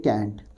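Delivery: "can't" with a front vowel, like kaent; accent variant. Shown as a demonstration. Teacher repeats one variant for students, "can't" with a front a. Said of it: "कुछ लोग बोलते हैं".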